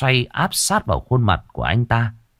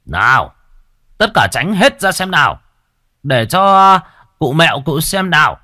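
raised, commanding henchman's shout; shouting orders; 7 semitones higher, 5 dB louder than usual. Acted. Servants clear the crowd for the village chief, shouting. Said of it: "lớn tiếng quát những người xung quanh".

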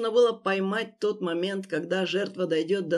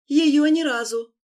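desperate shout; 7 semitones higher, 7 dB louder than usual. Acted. Victim blurts out.

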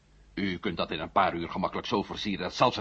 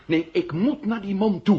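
louder and higher, insisting hard; insistent outburst; 10 semitones higher, 7 dB louder than usual. Acted. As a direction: push back firmly, voice up. Insisting he must go himself.

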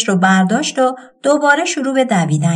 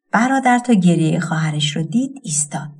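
ordinary delivery

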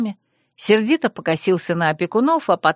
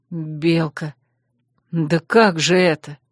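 stunned father; 3 semitones lower, slower than usual. A narrator's reading.

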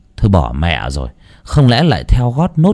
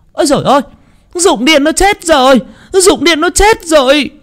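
high, loud panicked wail; wailing scream; 4 dB louder than usual. A narrator's reading. Woman finding the body screams to the village, wailing. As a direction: scream out that he is dead. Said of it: "thì chị hét toáng cả lên".

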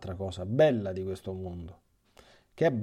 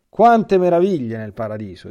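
loud exclamation; 3 semitones higher, 13 dB louder than usual. A narrator's reading. Riccardo's exclamation read aloud.

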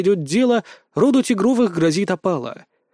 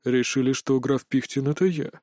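low, soft, dry question; cool, understated query; 5 semitones lower, 5 dB quieter than usual. A narrator's reading. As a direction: ask drily, unmoved. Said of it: "сухо спросил куратор".

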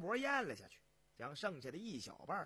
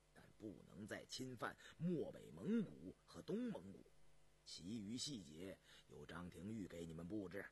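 low, quiet, weighty monarch; deep hushed imperial voice; emperor giving orders; 9 semitones lower, 7 dB quieter than usual. Acted. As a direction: instruct ministers softly from the throne. Emperor instructs his ministers.

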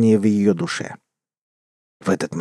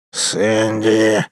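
hissed name. A narrator's reading. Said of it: "с шипением процедил волк".

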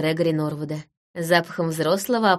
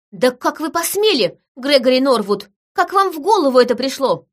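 high, indignant outburst; angry bellow; 6 semitones higher, 4 dB louder than usual. A narrator's reading. Woman slapping Gregory for his nerve, bellowing. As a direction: tear into them, raised and outraged. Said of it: "гаркнув во весь голос".

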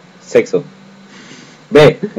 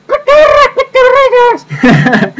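very high, loud, laughing squeal; squealed shout; 3 dB louder, much slower than usual. Acted. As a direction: shriek it with a laugh. Shouting the fan's catchphrase.

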